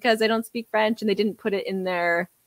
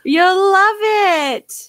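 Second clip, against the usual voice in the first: mock slogan; 8 semitones higher, 5 dB louder, much slower than usual. Mocking the app's catchphrase.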